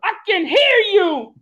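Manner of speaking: high, punchy hype-DJ shout-outs. Shown as a demonstration. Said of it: "Do your D J voice".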